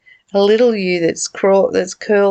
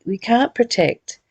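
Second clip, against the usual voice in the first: usual speaking voice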